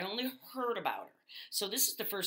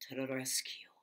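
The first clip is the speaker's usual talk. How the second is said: whispered; 6 semitones lower, 4 dB quieter than usual. Acted. Character finishing a mock-heroic self-introduction in a whisper.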